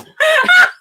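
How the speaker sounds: squealing burst of laughter; high laughter. Real, not acted. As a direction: crack up helplessly. Laughing hard at grandma.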